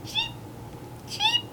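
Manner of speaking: very high little cheeps; chirping sparrows. A narrator's reading. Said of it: "The sparrows chirped".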